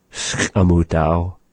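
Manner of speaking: slow demo of "Skamutau"; language example. Shown as a demonstration. Teacher modelling "Skamutau" slowly. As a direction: draw out "Skamutau" with a rise. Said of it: "They went".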